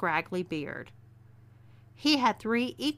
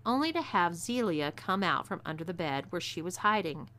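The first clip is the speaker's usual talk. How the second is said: ordinary delivery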